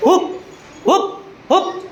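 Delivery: short, separate monkey hoops; monkey hoot. Shown as a demonstration. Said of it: "વાંદરાનો અવાજ કાઢ". (વાંદરાનું હૂપ હૂપ)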